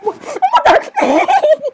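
very high, giggly squeak of shock. Acted. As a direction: squeal it in disbelief. Shocked reaction in skit.

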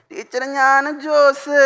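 put-on slow, high cute little-kid voice; cute child. Little boy answering teacher, slow and cutely high. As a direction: say it like a shy sweet first-grader. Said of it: "ക്യൂട്ടായിട്ട് പറഞ്ഞു".

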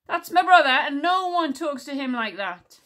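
loud, high, protective outburst; yelled. A narrator's reading. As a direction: shout it in defence of your brother. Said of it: "he yelled".

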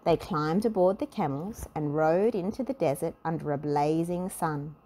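plain reading voice

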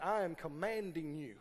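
normal talking voice

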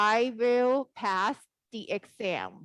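very slow, clear English clause; slow model sentence. Shown as a demonstration. Teacher showing the students the clause, very slow. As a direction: drag each word out for the class. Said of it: "นี่คือประโยค".

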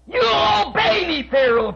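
a high shouted haughty command; mock Pharaoh demand. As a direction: bark it like an offended king. Pharaoh voiced in sermon story.

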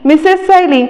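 high-pitched call, no real shout; understated narrated yell, pitched high; desperate calling out. A narrator's reading. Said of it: "तो जोराजोरात ओरडायला लागतो".

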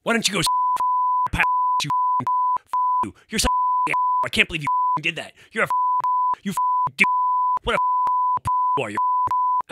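high squeaky falsetto imitation of a cursing cheerleader; imitating an enraged cheerleader in a high squeaky put-on voice; mock cheerleader tirade. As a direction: squeal the curses out fast in a mocking falsetto. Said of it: "and then she was like".

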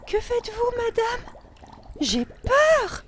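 put-on very high, loud scared little girl; squealing child's fear; frightened cry. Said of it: "cria Lola".

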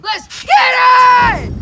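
slow, loud, high hype call; drawn-out hype shout. Real, not acted. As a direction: stretch it out and hype up. Hype before the second try.

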